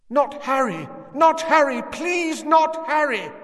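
high, loud desperate pleading scream; screaming woman. Acted. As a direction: beg for the child's life in terror. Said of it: "Someone was screaming".

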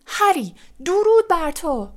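high-pitched hail; cheering shout. A narrator's reading. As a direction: call out in acclaim, pitch well up. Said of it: "شیمس فینیگان فریاد زد".

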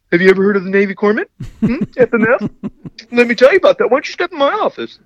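high, fast hard-sell recruiter pitch; relentless hard-sell friendliness; old recruiter voice. Retold or imitated. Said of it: "the old recruiter voice".

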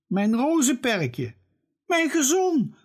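very high, lightly wailing cry. A narrator's reading. Father cries out at his wrecked rose bed, a touch of wailing. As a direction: whimper over the ruined lawn. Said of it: "huilt Noah's vader".

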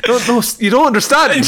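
high, pleading robber voice. Pretend. Robber pleading with the cashier, high and urgent. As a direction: beg like a panicked robber.

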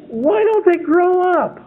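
drawn-out high exasperated question; mock despair at grown men acting like kids.

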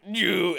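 high, loud mock engine-like whoosh; imitated yelp. Boy fleeing stingray in the boat, high mock yelp. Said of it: "then just went".

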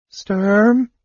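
high, wavering frightened call; quavering. A narrator's reading. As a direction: let the voice wobble with fear. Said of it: "he quavered".

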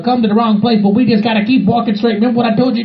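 ordinary talk

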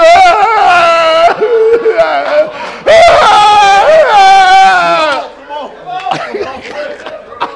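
long, high, loud comic howl; hammed-up wail. Husband's tirade boils over, hammed-up screaming wail. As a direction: let the tirade break into drawn-out shrieking.

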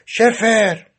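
mild shouted summons. A narrator's reading. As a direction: bark for the guard across the cell. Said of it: "به سبک خودش داد زد".

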